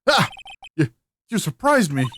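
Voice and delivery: high, stammered yelp of surprise; jump, then stumble over the words; startled. Acted. Phoenix caught off guard by someone, startled.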